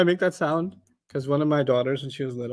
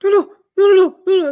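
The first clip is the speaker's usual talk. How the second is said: rising panicked no's, higher and louder; pleading no; 13 semitones higher, 5 dB louder than usual. Retold or imitated. Parent pleading with toddler before the meltdown, rising panic. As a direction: beg the child not to erupt. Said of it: "You're like".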